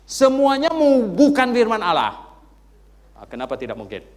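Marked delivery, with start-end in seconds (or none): 0.0-2.2 loud preacher pressing the question; preaching at full force. Acted. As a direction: drive the point to the crowd. Berkhotbah dengan semangat penuh. (khotbah lantang dan tegas)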